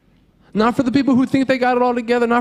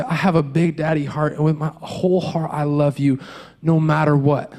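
tender dad; 7 semitones lower than usual. Retold or imitated. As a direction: hush them gently like a soothing father. Dad hugging his little daughter.

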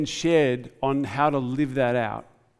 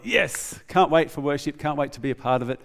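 eager churchgoer. Acted. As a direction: play someone who can't wait for worship. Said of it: "sometimes we can be like".

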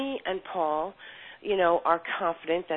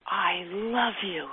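tender; 3 semitones higher, much slower than usual. Retold or imitated. Mother speaks to her newborn.

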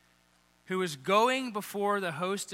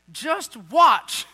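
loud, higher exasperated order; angry command; 5 semitones higher, 8 dB louder than usual. Retold or imitated. Moses ordering the grumbling people, loud angry command. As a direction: bark it, fed up. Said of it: "like, they're angry".

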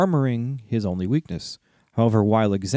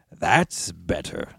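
growling dragon guarding treasure; 5 semitones lower, 3 dB quieter, much slower than usual. Acted. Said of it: "said Smaug".

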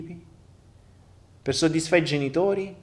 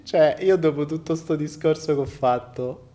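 laughing. Real, not acted. Reacting laughing to an atheist comment.

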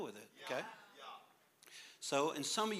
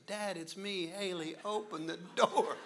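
lightly voiced daughter; 6 semitones higher than usual. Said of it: "I heard my daughter say".

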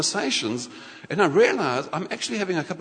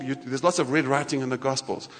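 ordinary delivery

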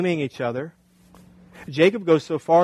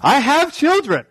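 incredulous husband; 6 semitones higher, 3 dB louder, slower than usual. Retold or imitated. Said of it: "He's like".

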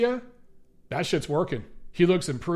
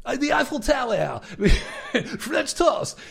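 higher mock French accent through laughter; laughing French accent; 6 semitones higher than usual. Listing French clichés, laughing mock French accent. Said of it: "my French accent".

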